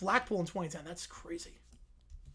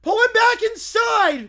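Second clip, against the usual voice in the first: shouting; 10 semitones higher, 9 dB louder, slower than usual. Acted. Crowd shouting instruction.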